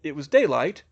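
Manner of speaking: ordinary delivery, nothing marked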